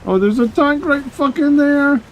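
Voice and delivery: high alarmed yell, tank spotted; startled shout. Real, not acted. Sudden tank spotted in game.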